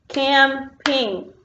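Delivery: "Camping" broken into two beats, cam-ping; split into syllables. Shown as a demonstration. Teacher clapping syllables with pupils, "Camping" in two separate beats. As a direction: clap out "Camping" slowly, syllable by syllable. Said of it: "clap with me on the syllables".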